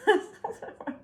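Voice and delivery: a burst of silent, wheezy laughter; breathless giggle. Real, not acted. Vlogger breaks into a breathless giggle. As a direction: crack up on camera while talking about packaging. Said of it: "I can't even speak now".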